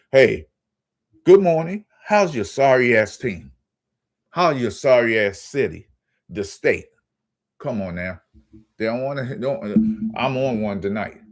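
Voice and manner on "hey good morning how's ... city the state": loud sarcastic hello to rival fans; taunting greeting. Pretend. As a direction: greet them brightly, then twist the knife. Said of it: "how about say".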